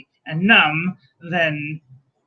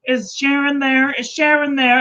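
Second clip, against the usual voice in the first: whiny caller voice; 7 semitones higher than usual. Retold or imitated. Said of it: "be like".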